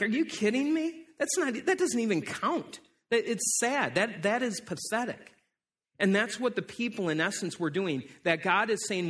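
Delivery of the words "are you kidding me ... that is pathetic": higher-pitched indignant protest; mock disbelief. Receiver of a regifted broken gift, incredulous. Acted reaction to a bad gift.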